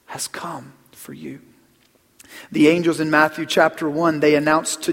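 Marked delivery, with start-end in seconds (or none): 0.1-1.4 soft, slow, breathy whisper; hushed whisper. Real, not acted. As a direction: let it fall to a whisper. Whispered climax of sermon.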